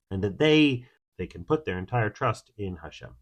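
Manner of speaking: usual speaking voice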